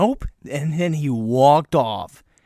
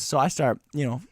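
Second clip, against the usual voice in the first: ordinary talk, nothing marked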